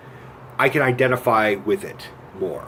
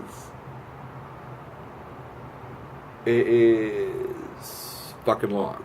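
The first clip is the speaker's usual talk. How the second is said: very slow, stretched-out delivery; drawn out for length; 7 dB quieter, much slower than usual. Shown as a demonstration. Reviewer showing how long the movie is, drawn out. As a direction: drag it out to show the length. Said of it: "That's how fucking long it is".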